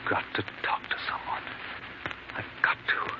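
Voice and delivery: breathless, voiceless whisper, barely holding together; desperate whisper. Acted. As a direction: whisper it like you're about to crack. Desperate man alone on phone.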